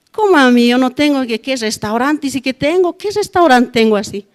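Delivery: reclamo indignado, más agudo y más fuerte; queja enojada. Retold or imitated. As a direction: protestando ofendida ante una acusación injusta. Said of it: "Me he enojado así dentro de mí". (queja airada, casi gritando)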